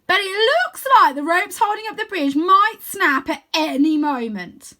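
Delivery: put-on high, slow and loud goofy boy; calling down in a big high cartoon voice; bright playful wizard-boy voice; Wyn's voice. A narrator's reading. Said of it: "his voice echoing down to us".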